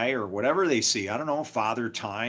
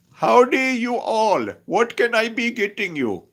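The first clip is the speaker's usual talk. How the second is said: much higher imitated Indian accent on a Southern greeting; Indian accent with Southern words; 10 semitones higher, 7 dB louder than usual. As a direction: play a waitress in an Indian accent greeting you Southern-style. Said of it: "Hindi with a, with a Southern accent".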